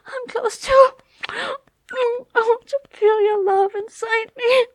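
high, loud, tearful begging; ecstatic pleading. Acted. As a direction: cry out in ecstasy. Loud pleading climax talk.

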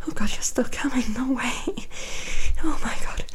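hushed, tender afterglow whispers; soft whisper. Acted. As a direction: whisper softly after climax.

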